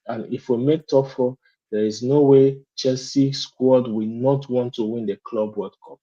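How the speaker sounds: normal talking voice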